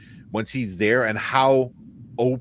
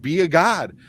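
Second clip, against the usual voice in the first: higher and louder, building to be a god; passionate TikTok rant; 8 semitones higher, 5 dB louder than usual. Retold or imitated. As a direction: preach it like a fired-up fan. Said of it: "He goes".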